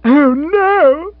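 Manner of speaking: high, loud cry of dismay; a shrill little scream; mild scream. Acted. Jill reacting to her horse left at the post, screaming. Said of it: "Jill screamed shrilly".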